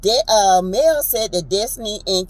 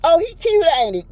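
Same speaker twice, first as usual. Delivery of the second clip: high, slightly louder teasing coo; lightly voiced Stormy, pitched way up; imitating Stormy; 8 semitones higher, 4 dB louder, faster than usual. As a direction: coo it up high, teasing about the cute kid. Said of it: "Stormy said".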